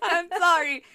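high, loud panicked sorry; flustered apology. Retold or imitated. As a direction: blurt it out, mortified. Repeated apology.

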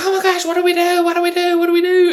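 high-pitched hammed-up panic; mock freak-out. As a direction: imitate flustered kids panicking, voice way up high. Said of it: "then everybody's freaking out".

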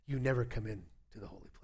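whispered, voice barely there; hushed whisper. Retold or imitated. God whispering to the pastor at the tabernacle. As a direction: hush it like a divine secret.

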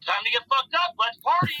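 high, hyped party call; rally everyone to party; excited. Retold or imitated.

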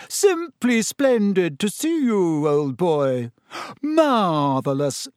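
slow, theatrical overblown politeness; mock-pompous greeting. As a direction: gush with exaggerated, drawn-out grandeur. Twins parody a pompous greeting.

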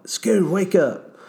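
teasing, slow wake-up call; waking a sleeper. Retold or imitated. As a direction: drag the words out to rouse him. Kicking a sleeping friend's cot.

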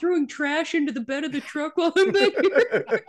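a hint of a pitiful sick boy; mock sick boy. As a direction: plead from the truck bed, laughing. Sick boy voiced from truck bed.

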